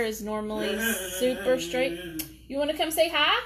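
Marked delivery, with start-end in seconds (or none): 2.5-3.4 high coaxing voice to the dog; pet talk. Real, not acted. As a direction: sweet-talk the dog over to the camera.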